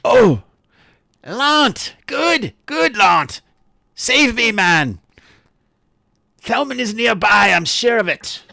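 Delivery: high, loud cry for rescue; excited gaunt character. Acted. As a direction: cry out high, pleading to be saved. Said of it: "the last thing he said before they came in, he's like".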